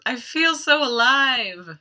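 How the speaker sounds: high, slow, loud elation; exultant. Retold or imitated. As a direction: imitate a thrilled cry, drawn out. Old lady exults after beating guard.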